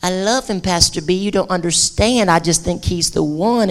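soft, dreamy lovesick voice; quietly pleading lovestruck tone; lovesick church member. Retold or imitated. Church member pleading with the pastor, soft and lovesick. Parishioner gushing about boyfriend.

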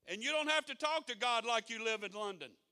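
loud, high, impassioned preaching; fiery sermon; preaching at full force. Acted. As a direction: shout it out from the pulpit.

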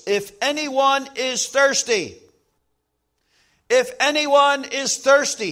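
slow ringing call, voicing Jesus; loud proclamation. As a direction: proclaim it slowly like Jesus at the feast. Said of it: "said in a loud voice".

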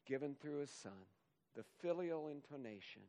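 usual speaking voice

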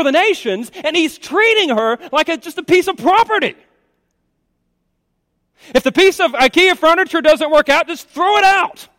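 high, mounting indignant sermon voice; impassioned preaching. Acted. Preacher decrying a woman treated like property, indignant. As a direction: build to a loud, outraged cry.

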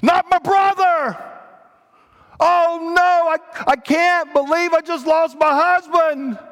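high, loud wail of grief; crying and screaming. Acted. Egyptians discovering their dead on Passover night, screaming. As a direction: scream it out in anguish, drawing words out. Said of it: "you hear people crying and screaming".

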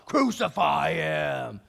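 slow, drawn-out crowd cry; long, heavy chant; crowd's answer. Acted. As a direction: stretch it out like a mob's reply. Crowd answers Pilate's cheer request.

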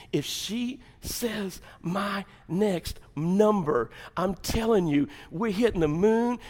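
drawn-out, high, held-back excitement; tense anticipation. Acted. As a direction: stretch out the suspense as the player. Acted lottery player awaiting last number.

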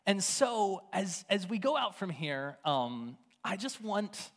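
ordinary delivery

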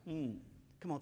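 usual speaking voice

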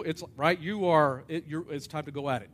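ordinary talk, nothing marked

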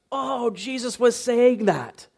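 high, slow 'oh, now I get it'; dawning realization. Acted. Preacher voices readers connecting it back to John, dawning realization. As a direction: act out the penny dropping, pitched well up.

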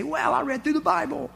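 a high, proud little boast; proud reader. Pretend. Telling the congregation what they'll be able to say, proud boast. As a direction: brag about finishing the Bible.

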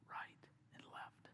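hushed, slowed echo of "Right and left"; whispered echo. Real, not acted. Repeated as a whisper.

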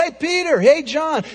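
calling out to the disciples. Retold or imitated. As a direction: shout across to friends. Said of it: "He didn't call out".

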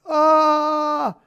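high, loud yelp; imitating a hurt friend's yelp; retold cry of pain. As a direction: yelp like a dislocated shoulder. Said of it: "he's like".

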